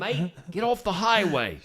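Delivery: put-on Aussie accent; hammed-up Australian accent. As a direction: play a mock Australian calling out. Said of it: "I was doing an Australian accent".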